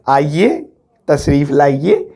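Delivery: low, slow, respectful welcome; polite formal invitation. Shown as a demonstration. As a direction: invite the guest in with great respect. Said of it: "देखो इज्जत देखो".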